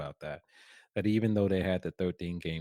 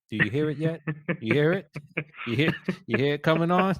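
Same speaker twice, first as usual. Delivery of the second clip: mock Southern accent; 7 semitones higher, 6 dB louder, faster than usual. Podcast host teasing co-host, playful mock Southern accent. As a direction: show off the Southern accent he is learning. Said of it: "I'm working on my Southern accent".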